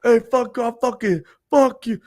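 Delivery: imitating high, strained heckling; mock belligerent holler, high-pitched; drunken yelling. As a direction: yell abuse at everybody in bursts. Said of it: "starts fucking yelling at everybody".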